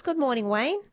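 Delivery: usual speaking voice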